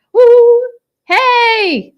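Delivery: high, loud whoop and hello; excited cheer. Real, not acted. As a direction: greet the viewers with a shout. Greeting viewers.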